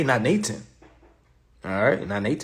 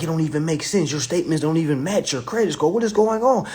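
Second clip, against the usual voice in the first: incredulous lender; 6 semitones higher, 5 dB louder, faster than usual. Retold or imitated. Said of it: "They be like".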